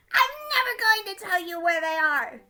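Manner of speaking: loud, high captive's outburst; defiant cry. Acted. Gingerbread man refusing his interrogators, high loud defiance. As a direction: refuse at the top of your voice.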